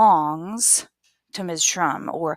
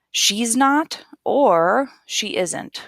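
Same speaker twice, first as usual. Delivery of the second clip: very slow "She's not or she isn't"; slow model; 4 semitones higher, much slower than usual. Shown as a demonstration. Teacher slowly models both negatives to the class. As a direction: stretch each word for learners. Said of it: "we say it like this".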